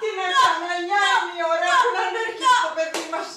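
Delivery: high, loud lament; loud anguished wail; despairing cry. Acted.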